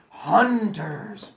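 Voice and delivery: loud, high call of the word; father naming the figures. A narrator's reading. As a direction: announce it like an old storyteller. Father's voice calls out.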